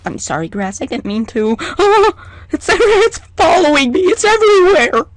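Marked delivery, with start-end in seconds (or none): 1.8-5.1 higher, louder mock panic; playful panic. Real, not acted. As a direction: squeal in playful fright. Being followed, panicking.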